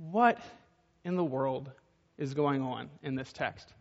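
slow and quiet, pausing between words; slow, hushed rhetorical question. Shown as a demonstration. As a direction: linger on each piece of the question.

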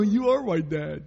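high, loud hammed-up barking orders; exaggerated bossy dad piling on orders; mock drill sergeant dad. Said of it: "And I go".

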